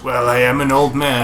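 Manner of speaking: lightly voiced old man; a hint of an old man. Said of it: "Can you do an old man voice?".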